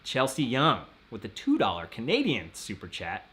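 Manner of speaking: ordinary talk, nothing marked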